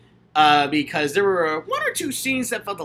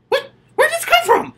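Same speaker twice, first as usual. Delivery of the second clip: high, loud, bewildered yelp; surprised at her own tears at the movie; startled surprise; 6 dB louder than usual. Retold or imitated. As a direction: act shocked that tears came out of nowhere. Retold sudden tears at movie.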